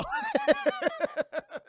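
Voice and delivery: loud, very high laughing; high cackle. Real, not acted. Streamer reacting to a chat message, high cackle. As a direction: burst into a shrieky cackle. Own laugh after remark.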